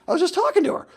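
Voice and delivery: high, loud, baffled squeal; comic high-pitched bewilderment; mock disbelief. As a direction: exclaim it way up high, utterly baffled. Retold bafflement at friend vanishing.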